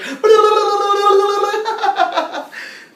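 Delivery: long, loud, high babble imitation; mock speaking in tongues. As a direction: mimic wordless gibberish trailing into a cackle. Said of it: "why are you speaking like that".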